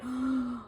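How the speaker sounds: a quick breathless gasp for air; demonstrated gasp. Shown as a demonstration. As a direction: gasp like surfacing from the pool. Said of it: "your chest is just like".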